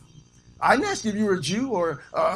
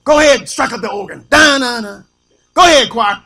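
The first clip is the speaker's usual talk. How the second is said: loud, punchy call to the musicians; showboating preacher parody; 8 dB louder than usual. Acted. Preacher cueing organ and choir, loud mock holler. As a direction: holler orders like a hammed-up preacher. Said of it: "He gonna get there and start going".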